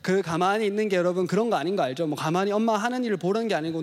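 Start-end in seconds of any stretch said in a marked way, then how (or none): none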